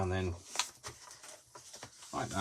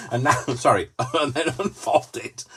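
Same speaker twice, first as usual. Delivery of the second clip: higher, louder, laughing through the words; helpless giggling; 7 semitones higher, 7 dB louder than usual. Real, not acted. Presenter losing it while demonstrating a fold, helpless laughter. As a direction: try to carry on instructing through giggles.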